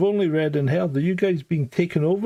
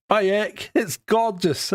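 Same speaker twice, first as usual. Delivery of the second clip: put-on Japanese accent; 5 semitones higher, slower than usual. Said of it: "in his Japanese accent".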